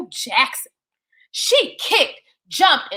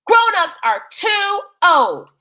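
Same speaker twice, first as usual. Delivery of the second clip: piped up; 6 semitones higher, slower than usual. Acted. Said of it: "Tiny Theo piped up".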